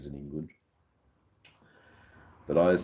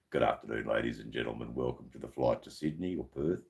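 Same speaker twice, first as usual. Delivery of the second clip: lightly voiced pilot announcement; 5 semitones lower, 6 dB quieter than usual. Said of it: "and then the captain speaks".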